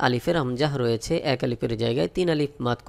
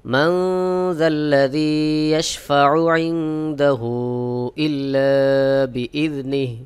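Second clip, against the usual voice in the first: slow chanted recitation; teacher models the verse in slow tajweed; Quranic recitation; slower than usual. Shown as a demonstration. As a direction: recite it slowly and melodiously.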